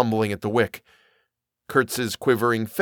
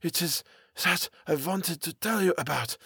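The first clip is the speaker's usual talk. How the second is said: put-on high, halting, accented character voice; dazed, faltering high voice; mumbling foreigner; 7 semitones higher than usual. A narrator's reading. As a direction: murmur brokenly in dread, pitched up. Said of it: "Kurtz mumbled".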